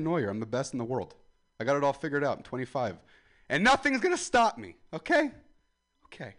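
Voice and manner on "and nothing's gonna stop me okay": cocky hype. Pretend. Mock cocky self-hype rant.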